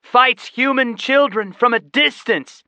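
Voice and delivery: loud, high mocking yell; boy yelling an insult; taunting yell. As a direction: provoke the dragon with scorn. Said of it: "Seth yelled".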